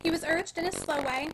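plain reading voice

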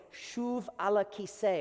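"Shuv ala kiseh" said in Hebrew, ala stressed; שוב על הכיסא. Shown as a demonstration. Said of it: "if I wanna say it in Hebrew".

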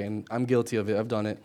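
ordinary talk, nothing marked

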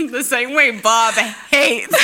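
slow, loud laughing shout. Pretend. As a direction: drag the words out, cracking up. Continues the mock headline, laughing.

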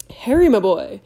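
a big jovial professor calling out; booming. A narrator's reading. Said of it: "said a booming voice".

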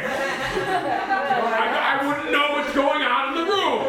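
high-pitched, exasperated defence; indignant argument. Acted. Father justifying why he held back, high and indignant. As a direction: argue back with the voice pushed up.

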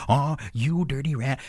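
slow gangster drawl, lightly done; a hint of a tough-guy sneer; James Cagney impression. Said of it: "people make fun of James Cagney".

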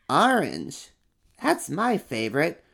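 a cheerful little one; second little monster. Acted. Second monster names its favorite color.

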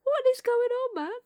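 high, loud disbelieving rant; mock bewildered coworker, high and loud. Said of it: "Just like".

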